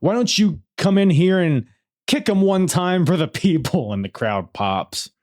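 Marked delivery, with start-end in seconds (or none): 0.0-3.9 higher-pitched mock yell; retold yell. Wrestler calling his partner into the ring, retold yell. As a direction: call out to him, pitched up. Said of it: "and he just yells".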